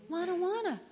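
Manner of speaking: put-on high, childish balking; mock whiny refusal. Believer resisting the voice's instruction, mock whine. Acting reluctance to follow scripture.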